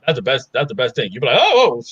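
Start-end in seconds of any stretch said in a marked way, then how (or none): 1.4-1.8 high-pitched excited "oh" cries; mock thrill-ride yelp; rider's cry.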